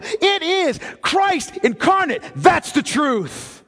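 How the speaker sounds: preacher declaring what truth is, building to a cry; full-force preaching. Acted. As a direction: thunder it from the pulpit.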